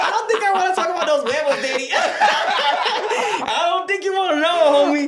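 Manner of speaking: slow giggling punchline; laughing joke. Retold or imitated. Joking about what he'd say to dad, laughing. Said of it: "I was like".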